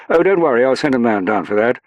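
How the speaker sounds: high, loud upper-class English assurance; upper-class English accent. A narrator's reading. Colville reassuring Saffron about her bag, upper-class English accent. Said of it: "in an upper-class English accent".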